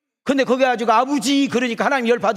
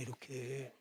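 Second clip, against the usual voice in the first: ordinary talk, nothing marked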